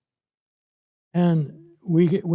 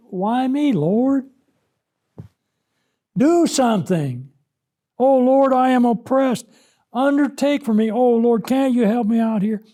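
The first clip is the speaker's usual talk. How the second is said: higher-pitched cry to the Lord; raised, imploring prayer voice; acted plea; 6 semitones higher, 3 dB louder than usual. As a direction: plead upward to God as the oppressed sufferer. Acting out Hezekiah's plea to God.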